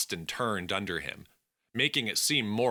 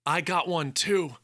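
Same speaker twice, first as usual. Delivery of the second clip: a lighter, higher second man; higher companion voice; 9 semitones higher than usual. Acted. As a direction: voice the sidekick higher. Alex answers from the left.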